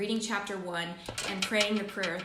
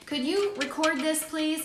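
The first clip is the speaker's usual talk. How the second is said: quoting a client; 6 semitones higher, 4 dB louder, slower than usual. Retold or imitated. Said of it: "and he said".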